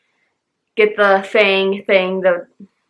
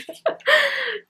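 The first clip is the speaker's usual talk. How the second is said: short, high, soft giggle; high laugh; 4 dB quieter than usual. Real, not acted. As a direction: giggle at yourself. Laughing at her own note.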